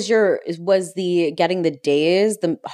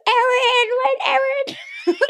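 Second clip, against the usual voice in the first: put-on drunk voice; much slower than usual. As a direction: yell like a drunk wedding guest. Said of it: "That's my drunk voice".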